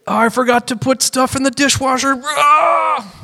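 high wail rising into a cry; hammed-up despair over a trifle; mock anguish. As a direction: wail as if tearing your clothes. Pretend clothes-tearing anguish over a chore.

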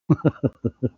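short chuckle; amused chuckle. Real, not acted. After saying he will mention no names, amused chuckle. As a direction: chuckle briefly to yourself. Hints at name, then chuckles.

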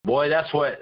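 normal talking voice

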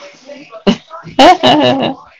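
loud, high excited laughter; big laugh. Real, not acted. Host laughing at the poem, loud. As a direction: burst out laughing.

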